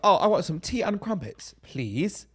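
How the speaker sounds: high opening, mock fake-British; bad American-doing-British accent. Showing how Americans imitate Brits, mock British accent. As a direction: put on a clumsy British accent, dropping on "please". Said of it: "when they say, they go".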